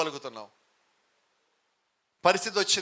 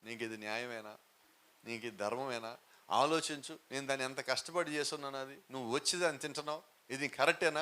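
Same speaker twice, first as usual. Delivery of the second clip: softer, meek pleading to a dog; timid mock plea; 4 semitones lower, 7 dB quieter than usual. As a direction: mockingly plead with the dog instead of chasing it. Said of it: "అమ్మో... గట్టిగా అంటాడేమో".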